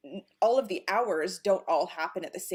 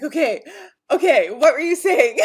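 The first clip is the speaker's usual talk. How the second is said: laughing okay; 7 semitones higher, 9 dB louder than usual. Retold or imitated. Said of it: "and then I'm like".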